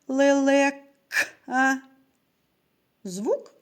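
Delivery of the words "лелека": "лелека" with the к held apart and emphasised; fifth sound stressed. Shown as a demonstration. Teacher asking children for the next sound, isolating the к in "лелека". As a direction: set off the к in "лелека". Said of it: "Наступний звук у слові".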